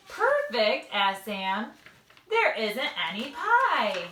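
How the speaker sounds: lively read-aloud with character voices; bright storybook reading for little listeners; reading to children. Acted. Mom reads a picture book to second graders, lively and animated.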